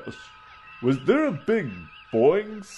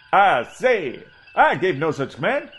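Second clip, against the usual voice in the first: outraged Southern gentleman, a touch higher and louder; indignant southern doctor; 7 semitones higher, 4 dB louder than usual. Acted. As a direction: bluster in offended Southern pomp. Coburn outraged at helmsman.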